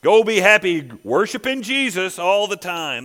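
loud, scoffing dismissal; mock the worshipper with exaggerated emphasis; sarcastic. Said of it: "That's just sarcasm".

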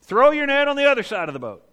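loud, high call across the water to a boat; hollering. Retold or imitated. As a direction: shout it out from the shore. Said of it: "hollers out at Peter".

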